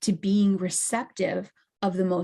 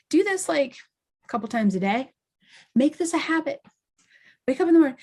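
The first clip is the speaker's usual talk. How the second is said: excited; 6 semitones higher, faster than usual. Real, not acted. Excited instruction, pitch jumps.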